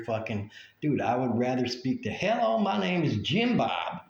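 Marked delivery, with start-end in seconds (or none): 2.1-4.0 higher-pitched, put-on American country-boy accent; mock Jim Bob accent. American call-center worker greeting a caller, mock Jim Bob accent. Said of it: "I can totally understand that accent".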